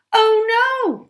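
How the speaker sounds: high, loud dismayed cry; cried out. Acted. Goldie cries out over the dropped cakes. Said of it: "Goldie cried".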